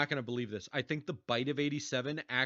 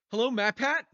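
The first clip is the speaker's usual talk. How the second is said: pretend phone call; 9 semitones higher, 8 dB louder than usual.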